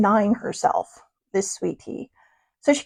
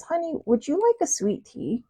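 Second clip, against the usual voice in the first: soft sweet waitress voice; 5 semitones higher, 8 dB quieter than usual. Retold or imitated. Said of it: "she says".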